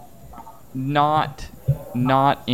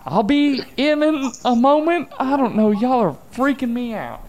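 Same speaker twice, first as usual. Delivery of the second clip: much higher, louder character call; 13 semitones higher, 5 dB louder than usual. Acted. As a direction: shout it through the door, rattled. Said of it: "he heals up".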